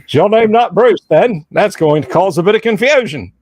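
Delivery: lightly hammed Bruce sketch voice; Aussie accent, half-hearted impression; mock Australian accent. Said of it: "Monty Python's philosophy department".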